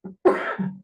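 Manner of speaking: a sharp dry cough from a cold; sudden cough mid-lecture; real cough. Real, not acted. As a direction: cough once, sick with the flu. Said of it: "estoy con un poquito de tos".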